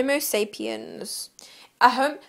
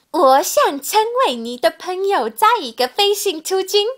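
high-pitched Mandarin line read; character take; 8 semitones higher, 4 dB louder than usual. Acted.

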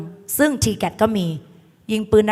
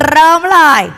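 loud, high rallying call; teacher's high, loud start signal; 7 semitones higher, 8 dB louder than usual. Real, not acted. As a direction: kick off the class with a shout. Calling class to start.

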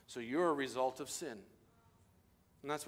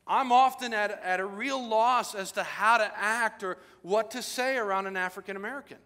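full-force preaching; 6 semitones higher, 7 dB louder than usual. Acted.

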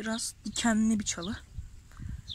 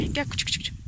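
calling a puppy; 4 semitones higher, faster than usual. Real, not acted. Farmer coaxes a frightened stray puppy, breathy calling. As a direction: coax the stray pup over in a breathy call.

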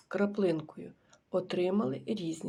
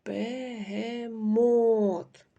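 Бегемот very slow and louder, the last syllable МОТ held long; stress on бегеМОТ; 3 semitones higher, 5 dB louder, much slower than usual. Shown as a demonstration. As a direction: stretch out Бегемот, land hard on МОТ. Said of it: "Який склад буде наголошеним?".

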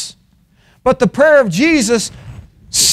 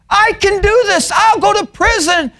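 confident boast; 10 semitones higher than usual. Acted. Preacher voicing Peter vowing loyalty before the test, confident boast. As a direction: act out Peter's overconfident vow. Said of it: "No, he was confident".

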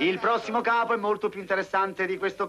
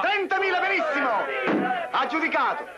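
auctioneer's call; 6 semitones higher than usual. Acted. Auctioneer closing the sale.

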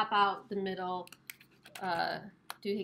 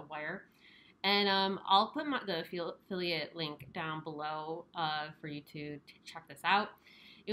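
muffled behind paper mask; speaking into covered mouth; through a face mask; 3 semitones lower than usual. Real, not acted. Seen 4: Disposable mask covers mouth and nose throughout; reference frames unmasked.

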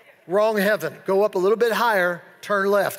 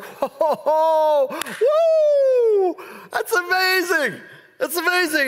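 high, loud woo, then a slower amazed that's amazing; delighted Jesus; 9 semitones higher, 4 dB louder, much slower than usual. Retold or imitated. Preacher voicing Jesus thrilled at his disciples, excited high shout. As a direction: cheer them on like a thrilled teacher.

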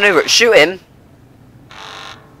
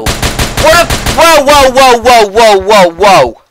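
louder, higher whoa easing down; startled whoas; 8 semitones higher, 8 dB louder, slower than usual. Real, not acted. Gamer reeling at sudden chaos on screen, loud whoas. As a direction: react as everything goes wild.

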